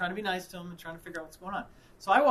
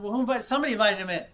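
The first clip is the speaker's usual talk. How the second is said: loud, raised-pitch retort; exasperated insistence; 5 semitones higher, 10 dB louder, much faster than usual. Retold or imitated. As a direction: replay an argument, pushing the voice up and out. Replays his insistent reply to wife.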